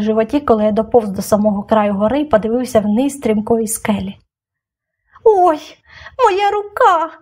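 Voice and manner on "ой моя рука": whimpering. A narrator's reading. Injured girl calling up the cliff, high pained whimper. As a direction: cry up from far below in pain. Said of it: "Щось рюмсало".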